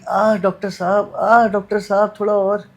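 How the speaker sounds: teasing her by imitating how she will moan; mock moaning patient. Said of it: "और तुम करोगी".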